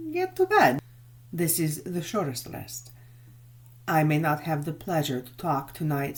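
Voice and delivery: put-on courteous, lightly accented old nobleman; the Count speaking. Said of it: "he said".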